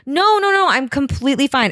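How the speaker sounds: high, rapid-fire mock protest; insistent protest. Retold student turning down a nap, insistent protest.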